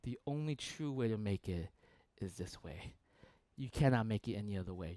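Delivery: low and soft, flatly refusing; wife's low, flat insistence. Retold or imitated. Wife refusing any other recipe, low and firm. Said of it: "she said".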